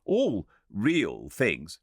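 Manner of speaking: lightly voiced argumentative guest; Philby. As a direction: drag out all real things. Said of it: "an argumentative person".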